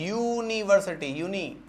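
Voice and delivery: यूनिवर्सिटी slowly, opening on a clear "yoo"; pronunciation demo. Shown as a demonstration. Teacher asks how it is pronounced, says यूनिवर्सिटी slowly with a clear "yoo". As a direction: draw out the "yoo" in यूनिवर्सिटी, then isolate यूनी. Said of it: "इसका pronunciation क्या है?".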